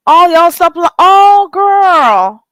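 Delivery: put-on loud, high "all y'all stop lying" breaking into "oh, girl"; mock call-out. Scolding her chat playfully, loud then sugary. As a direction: yell at the chat, then switch to gushing.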